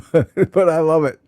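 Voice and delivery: laughing through the words "but I love it". Real, not acted. Laughs at her viewers' rut.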